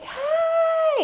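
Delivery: put-on very high, bright little-kid hi; toddler greeting. Neighbor's three-year-old son greeting, high child voice. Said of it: "he comes and is like".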